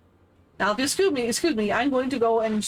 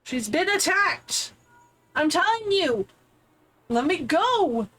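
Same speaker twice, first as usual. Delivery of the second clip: high, insistent exasperation; pissed off; 6 semitones higher, slower than usual. Real, not acted. Player demanding to go help her, pissed off. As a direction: plead angrily, pitch pushed up. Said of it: "I'm so pissed off".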